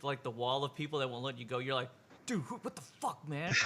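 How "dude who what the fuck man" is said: annoyed guy. Acted. GM voices annoyed NPC.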